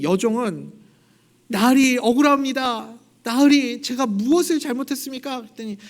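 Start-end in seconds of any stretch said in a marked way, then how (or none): 1.5-5.4 loud, high, begging cry; desperate pleading. Retold or imitated. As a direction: plead for your life with the master. Maid begs the nobleman.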